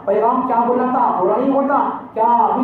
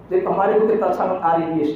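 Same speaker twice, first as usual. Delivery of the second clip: normal talking voice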